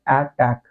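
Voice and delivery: अटैक pronounced "ae-tak", both vowels alike; corrected first vowel. Shown as a demonstration. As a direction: start अटैक with the a of "cat". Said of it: "ये अटैक होता है". (अटैक को 'ऐटैक' बोलें)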